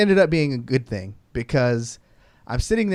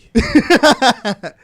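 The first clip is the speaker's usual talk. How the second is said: loud laugh; 9 semitones higher, 9 dB louder than usual. Real, not acted. Laughing hard at the story.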